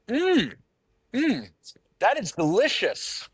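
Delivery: high, swooning foodie voice; vegan eating fake meat. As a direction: coo over the burger, way up high. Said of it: "here's my impression of, uh, a vegan, anyone eating Impossible Burger".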